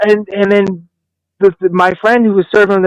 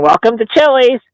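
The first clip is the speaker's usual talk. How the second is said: higher mock-chirpy welcome; cheery server greeting; 6 semitones higher than usual. Waiter mocking the cheery greeting he lacked, fake cheery. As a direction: chirp it like a chain restaurant host. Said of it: "like my cheery ass".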